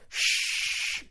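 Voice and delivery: long hissing spray noise. Shown as a demonstration. Imitating spraying body spray all over, long hiss. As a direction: hiss like an aerosol can. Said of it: "like a big S".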